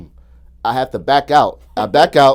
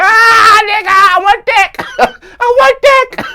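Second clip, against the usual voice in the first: high, loud, slow hammed-up shriek; crazed woman; 13 dB louder, slower than usual. Imagined woman chasing his car, a high slow shriek. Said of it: "ride the joint like".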